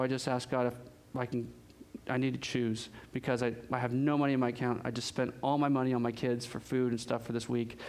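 a lightly voiced broke father close to tears; choked up. Friend without shoes tells the caller, quietly choked up. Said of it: "he's choked up".

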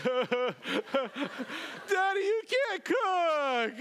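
hammed-up high, drawn-out bawling; sob, then bawl it slowly at a very high pitch; imitated son crying. Son in the car tells daddy, exaggerated imitated wail. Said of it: "then my son starts crying".

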